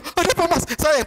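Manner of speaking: high-pitched imitation of an anxious wife; suddenly worried wife. Wife rushes to husband faking illness, mock concern. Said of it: "Istrinya langsung tiba-tiba".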